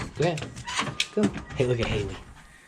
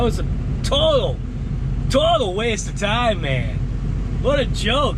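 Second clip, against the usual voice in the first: exasperated exclaim; 8 semitones higher than usual. Real, not acted. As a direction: throw it out with a laughing scoff. Exclaiming over wasted plowing.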